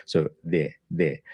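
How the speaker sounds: de with a soft D, like the TH in the; tongue to the teeth for the D in de; soft Spanish D. Shown as a demonstration. Teacher shows the Spanish D in de. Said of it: "your D is more like this".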